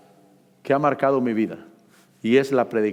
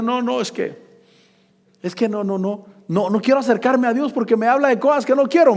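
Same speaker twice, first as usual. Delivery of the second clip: a hint of a whiny, stubborn refusal, higher; mock reluctant believer; 10 semitones higher than usual. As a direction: play the stubborn church member lightly. (imitando con burla al hermano que no quiere)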